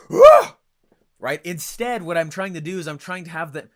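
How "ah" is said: loud, very high startled shriek; recoiling yelp. Retold or imitated. Imitating a listener recoiling from a hard sell, shrill yelp. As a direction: yelp like you've been pushed away. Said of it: "it makes them feel like".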